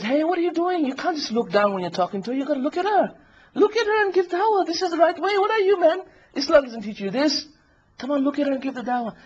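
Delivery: high-pitched, pushy scolding; indignant prodding. Acted. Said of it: "And she says".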